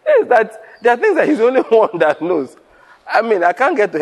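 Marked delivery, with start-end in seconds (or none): 0.0-2.6 higher-pitched, amused insistence through laughter; laughing. Real, not acted. Preacher to congregation after his own joke, laughing.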